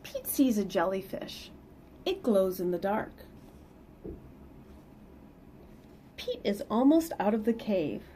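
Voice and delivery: lively read-aloud storytelling; picture book reading voice; story narration. Acted. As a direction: read it to the kids with bounce.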